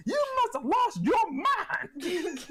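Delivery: high, slow laughing scold; scolding mom. Retold or imitated. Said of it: "while smacking".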